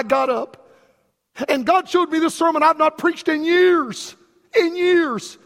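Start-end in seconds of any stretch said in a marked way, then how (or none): 1.5-5.4 real tears breaking through, pitched high; choked up with emotion; fighting back tears while preaching. Real, not acted. Voice breaks recalling God's word.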